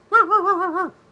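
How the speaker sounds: loud, very high burst of laughter; high-pitched cackle. Real, not acted. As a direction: let out a shrill giggling peal. Shows the little doggy sign.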